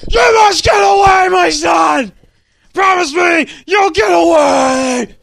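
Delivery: high, strained full-volume yell; wounded father. A narrator's reading. Dying father urging son to flee.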